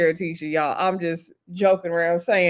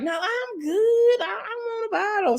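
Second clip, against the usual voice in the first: mock whining; 11 semitones higher, 4 dB quieter than usual. Tisha insisting she wants a bottle, mock high whine. Acted tipsy refusal, begging for bottle.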